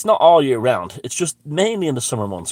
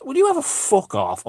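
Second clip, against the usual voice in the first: telling off; 13 semitones higher than usual. Retold or imitated. Telling the man off.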